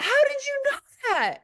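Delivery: high, wide-eyed amazement; mock amazed gasp of a question. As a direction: act dumbfounded.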